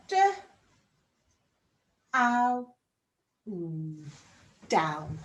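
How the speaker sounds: D, ow, n each sound apart, then blend into down. Shown as a demonstration. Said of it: "Sound out down".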